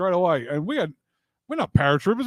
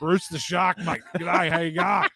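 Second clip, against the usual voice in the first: lightly voiced Australian shark, a hint of it; Australian accent, laid on casually; Bruce the Shark voice; 3 semitones higher, slower than usual. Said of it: "sounds just like the shark out of Finding Nemo".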